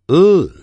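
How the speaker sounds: 呃 as a long, loud, voiced L; high, sustained L sound on 呃; L sound demo. Shown as a demonstration. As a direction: hum 呃 with the tongue tip on the upper gum. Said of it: "它的发音呢是".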